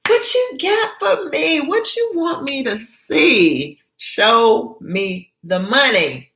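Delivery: put-on very high, eager girlish voice; giddy excitement. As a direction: Gina happily begging to see. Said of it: "So Gina's laughing, happy to see Ann".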